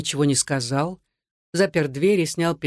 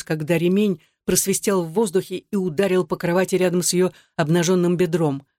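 plain reading voice

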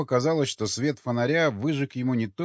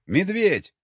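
high-pitched alarmed holler; sudden yell; 8 semitones higher, 4 dB louder than usual. A narrator's reading. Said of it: "заорал вдруг кто-то совсем рядом". (внезапный испуганный крик)

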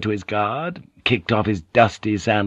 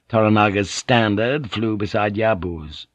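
ordinary delivery, nothing marked

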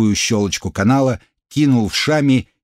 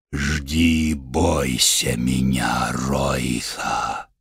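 very low, slow demonic boom; sinister god voice; 9 semitones lower, 5 dB quieter, much slower than usual. Acted. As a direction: menace him as an ancient evil god. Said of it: "зловещий шёпот".

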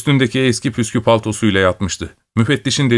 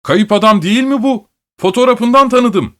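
high, louder, amazed recognition; excited exclamation; 11 semitones higher, 4 dB louder than usual. A narrator's reading. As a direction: sell the astonished discovery. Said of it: "diye bağırdı müfettiş".

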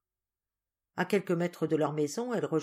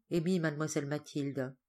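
ordinary delivery, nothing marked

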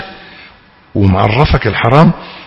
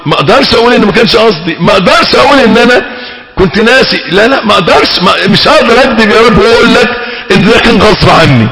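higher, louder, rushed plea to God; pleading sinner; 8 semitones higher, 3 dB louder, faster than usual. Acted. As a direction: beg like someone who cannot bring himself to lie. (تائب يتوسل بصوت عالٍ ومرتفع وسريع)

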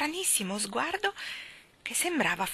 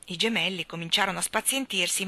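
plain reading voice

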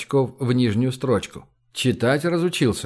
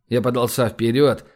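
ordinary delivery

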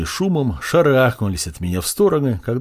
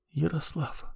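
barely audible, dying breath; faint whisper; 5 semitones higher, 12 dB quieter than usual. A narrator's reading. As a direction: whisper the name weakly. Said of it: "еле слышно прошептала она".

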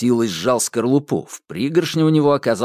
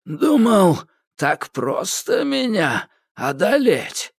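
wheezing taunt; 9 semitones higher, slower than usual. A narrator's reading. Weakened Koshchei taunts.

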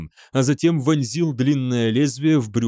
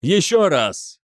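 raised, higher commanding cry; bellowed order; 5 semitones higher, 3 dB louder, slower than usual. A narrator's reading. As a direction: call out a repeat order. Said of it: "заорал Намаджира". (орёт приказ)

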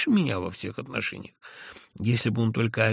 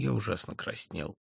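plain reading voice

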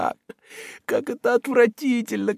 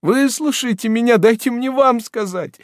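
very high and loud weeping plea; desperate sobbing appeal, much higher and louder; tearful begging; 11 semitones higher, 4 dB louder than usual. Acted. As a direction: implore the men through tears. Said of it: "молила несчастная, заливаясь слезами".

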